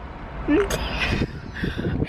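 breathy high giggle; shy giggle. Real, not acted. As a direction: let out a shy little giggle. Giggle when shy on camera.

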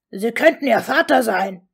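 loud outraged outburst; sudden shout. A narrator's reading. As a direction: burst out shouting in accusation. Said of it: "schrie Ron plötzlich".

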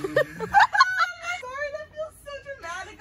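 high, slow, through laughter; laughing. Real, not acted. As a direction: squeak it out while laughing. Still laughing hard.

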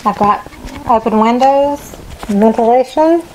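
muffled behind a respirator mask; through a respirator. Real, not acted. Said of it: "it's very hard to talk through the respirator".

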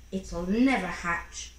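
ordinary delivery

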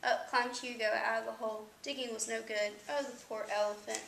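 ordinary delivery, nothing marked

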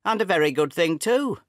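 father crying out at his son's plea; cried out. Acted. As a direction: fling it back at him. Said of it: "I cried".

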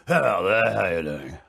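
posh, low imitation of Bob Hoskins; imitating Bob Hoskins. Mimicking actor Bob Hoskins's real posh voice. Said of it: "He's like".

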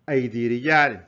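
lightly acted cry to a crowd; imitated public cry. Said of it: "صار يصيح في الناس".

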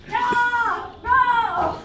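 shrill loud no's through laughter; high laughing screams. Acted. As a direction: shriek no while cracking up. Mock Korean dub screaming.

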